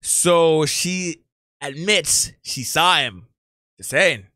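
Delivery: deadpan low sarcasm, drawn out; sarcastic aside. Real, not acted. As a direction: drop it low and slow, letting the point land.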